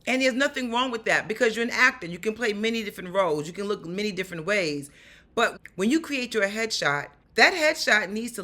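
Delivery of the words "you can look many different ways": normal talking voice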